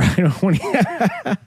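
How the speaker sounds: talking through a laugh; words breaking into laughter. Real, not acted. Laughs at the guest's résumé.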